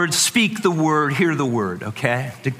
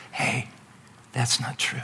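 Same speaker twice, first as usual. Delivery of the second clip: much softer, gentle Holy Spirit voice; quiet voice; 8 dB quieter than usual. Acted. The Holy Spirit answering the lies, in a much softer voice. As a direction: drop right down to a gentle hush. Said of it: "The Holy Spirit's voice is quieter".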